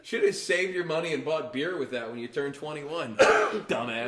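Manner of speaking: loud, hammed-up berating; loud comic telling-off, punching the insult; mock scolding. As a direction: chew him out as a mock judge, loud throughout. Acts judge scolding the divorced man.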